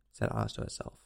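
plain reading voice

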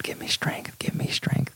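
breathy whispered plea for patience. Real, not acted. Vlogger whispering a plea for patience about a queen. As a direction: whisper it like a prayer through your teeth.

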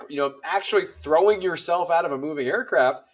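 ordinary talk

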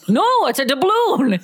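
high, wailing pirate insistence; mock pirate protest. Pirate defending the word, high mock wail. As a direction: cry it out like an indignant pirate.

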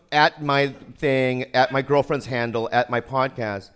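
each at punched, slow and loud; emphatic listing. Retold or imitated. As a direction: hammer out each at my. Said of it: "And then she goes".

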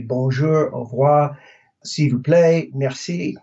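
bonjour, au revoir, s'il vous plaît, merci in a careful French accent; French greetings. Shown as a demonstration. Travel advice to Americans, courteous French pronunciation. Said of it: "you need to say".

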